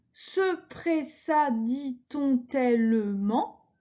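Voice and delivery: slow, syllable by syllable verse reading; scanning syllables slowly. Shown as a demonstration. Teacher scanning a verse for her class, slow and measured. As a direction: count out each syllable of the line as you read. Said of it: "Et puis on continue".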